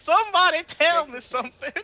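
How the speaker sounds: high, loud plea for answers; hammed-up bewildered outburst; Harvey. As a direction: beg loudly in a high, confused voice. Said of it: "Harvey goes".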